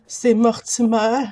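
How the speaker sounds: ordinary delivery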